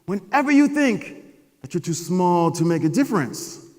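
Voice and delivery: loud, slow, solemn father voice; wise dad. Retold or imitated. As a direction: hand down wisdom like an old sage. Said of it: "He said".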